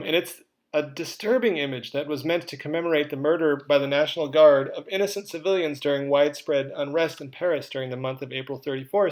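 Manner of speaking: ordinary delivery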